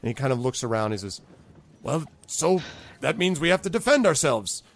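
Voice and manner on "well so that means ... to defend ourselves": high, decisive goblin chief; rally your people, voice pitched up; goblin boss, resolute. Acted. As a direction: boss taking charge.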